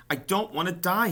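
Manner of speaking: high, loud tearful moan. A narrator's reading. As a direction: moan it through tears. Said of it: "he moaned".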